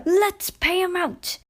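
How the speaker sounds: high, slow, spiteful coach voices; spiteful coaches. Acted. Coaches plotting against Sir Handel, high and spiteful. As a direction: scheme it like nasty little coaches.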